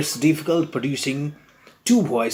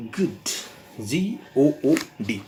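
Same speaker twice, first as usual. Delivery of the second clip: ordinary delivery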